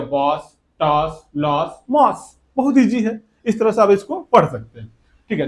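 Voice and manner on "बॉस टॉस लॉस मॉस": बॉस, टॉस, लॉस said slowly with an open "aa" vowel; ओ को आ जैसा, धीरे-धीरे. Shown as a demonstration. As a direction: list the words slowly, o like aa. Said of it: "तो क्या हो जाएगा?".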